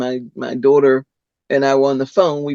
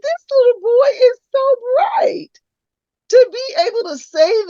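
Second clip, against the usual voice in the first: much higher, loud exclaiming; amazed; 3 dB louder than usual. Retold or imitated. Grandma marvelling at her clever grandson's comeback. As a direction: burst out in delighted disbelief.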